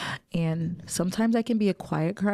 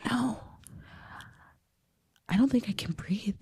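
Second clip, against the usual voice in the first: panicked whisper, barely voiced, gasping for air; breathless panic; 5 semitones higher, 8 dB quieter than usual. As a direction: whisper as if your lungs won't fill. Panic attack, can't breathe.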